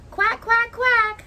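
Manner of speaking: high, punchy quacks; happy duckling. Acted. Ducky swimming with fish, happy high quacking. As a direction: quack happily, pitched well up. Duckling refrain in read-aloud.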